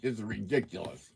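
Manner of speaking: ordinary talk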